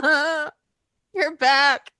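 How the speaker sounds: tearful, choked-up reunion; crying. Pretend. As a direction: pretend to cry with joy. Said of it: "I'm gonna be like".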